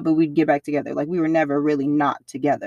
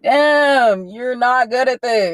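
loud, teasing jab at a losing gamer; mock trash talk; 6 semitones higher, 6 dB louder, much slower than usual. Girlfriend mocking her boyfriend's gaming, teasing trash talk. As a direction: rib him hard about losing the game. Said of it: "I gotta talk the good shit".

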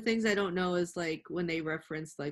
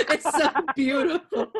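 laughing; 10 semitones higher, 7 dB louder than usual. Real, not acted. Echoing the quote while laughing.

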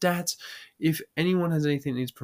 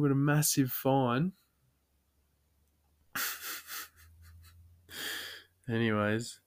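ordinary delivery

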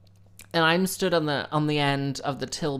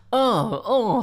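high, loud whiny oh oh; 7 semitones higher, 6 dB louder than usual. Retold or imitated. Shopper dithering at the till, a high loud whine. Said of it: "she's just like".

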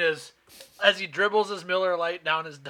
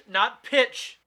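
high pitch; 6 semitones higher, 3 dB louder than usual. Shown as a demonstration. Correcting the falsetto idea, pitch jumps up. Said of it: "not pitch, but what accent".

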